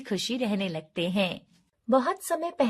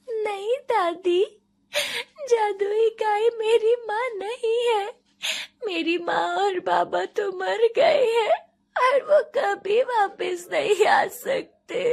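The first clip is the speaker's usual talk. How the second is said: very high, loud tearful wail; sobbing child; 11 semitones higher, 4 dB louder than usual. A narrator's reading. Orphaned girl telling grandmother her parents are gone, sobbing. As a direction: cry it out as a little girl, voice pitched way up. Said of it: "फूट फूट कर रोने लगती है".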